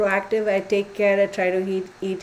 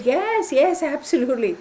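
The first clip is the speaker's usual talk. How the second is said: much higher, eager retold yes; 8 semitones higher than usual. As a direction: snap up the offer of more bread. Eager reply to bread offer.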